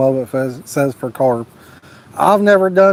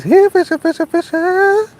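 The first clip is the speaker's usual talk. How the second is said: silly high voice; 13 semitones higher than usual. Acted. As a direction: squeak it out like a goofy cartoon. Reading a silly chat message.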